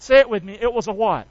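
usual speaking voice

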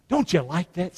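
usual speaking voice

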